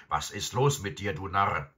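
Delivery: irritated farmer's outburst; angry farmer. A narrator's reading. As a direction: demand it of the dog crossly. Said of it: "inmitten seiner Wut".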